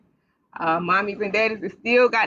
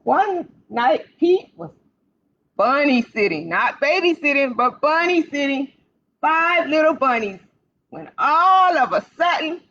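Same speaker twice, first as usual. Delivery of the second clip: put-on bright, sing-song read-aloud for little kids; storytime reading; 4 semitones higher, slower than usual. Librarian reading to children on a live stream, lively storytime voice.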